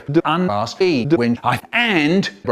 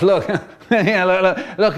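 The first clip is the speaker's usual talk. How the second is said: laughing; 4 semitones higher than usual. Acted. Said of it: "he starts to laugh".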